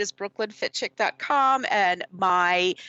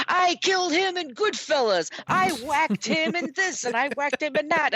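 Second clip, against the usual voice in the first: mobster actors bragging; 9 semitones higher than usual. Retold or imitated. Actors posing for photographers, mock mobster boasting. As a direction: ham up the gangster bravado.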